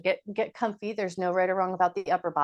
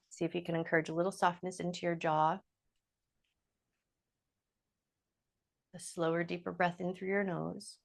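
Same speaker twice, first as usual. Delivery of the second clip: quieter, unhurried guided-breathing voice; soft breath guidance; 2 semitones lower, 5 dB quieter than usual. Acted. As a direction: ease them into a softer breath. Guides slow breathing cues.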